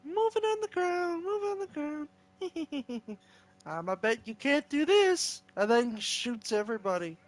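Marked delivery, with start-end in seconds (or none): 0.0-3.2 high, small cartoon voice; Mario. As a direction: squeak it out softly, like a little game character. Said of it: "The Mario head thing".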